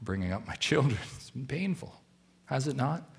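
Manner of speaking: rueful aside. Retold or imitated. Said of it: "some of us would look at that and say".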